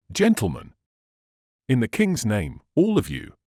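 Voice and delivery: urgent appeal called to a group; rally them with a brief command; hurried adjuration. A narrator's reading. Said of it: "With this hurried adjuration".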